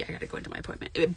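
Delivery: quick hushed whisper; whispered aside. Real, not acted. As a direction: whisper it fast in passing. Quick aside before appointment.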